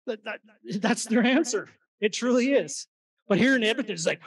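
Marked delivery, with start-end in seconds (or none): none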